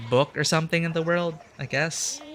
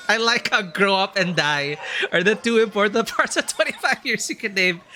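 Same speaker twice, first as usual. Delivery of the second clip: real amused laughter through the words, pitched up; laughing while talking; 5 semitones higher than usual. Real, not acted. Streamer reacting to own grim summary of the game, laughing. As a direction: talk through a fit of giggles.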